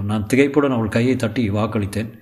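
usual speaking voice

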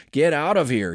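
narrated snarl with moderate force. A narrator's reading. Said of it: "he snarled at her".

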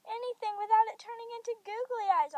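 mildly distressed storybook cry; cried out. A narrator's reading. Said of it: "I cried".

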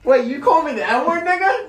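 high, loud disbelief breaking into laughter; shocked laughing call-out. Real, not acted. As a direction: call her out, half laughing.

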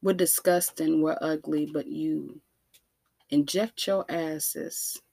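ordinary delivery

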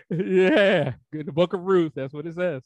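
ordinary talk, nothing marked

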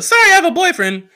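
high, loud sing-song sorry; mock a sweet polite rejection; prissy girl brush-off. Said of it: "I say it like that".

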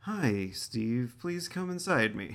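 soft, evenly voiced assistant tone; a gentle digital-assistant read; Siri voice. Co-host mimics Siri's smooth assistant voice to Steve. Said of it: "I imagine Siri would make it sound a little bit better".

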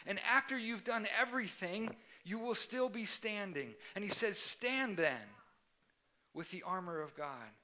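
full-force sermon climax; fiery preaching. Acted. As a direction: preach it out to the whole room.

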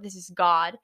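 ordinary talk

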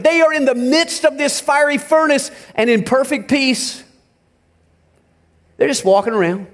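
ordinary delivery

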